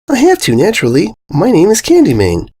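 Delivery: high, cheerful ditzy voice; ditzy pony voice. A narrator's reading. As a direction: state the obvious like a ditz. Candy Mane's voice in the story.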